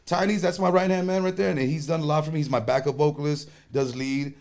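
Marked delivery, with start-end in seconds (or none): none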